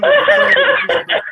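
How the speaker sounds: squeaky high-pitched accusing; falsetto, half laughing; high falsetto mock. Mocking the caller's claim.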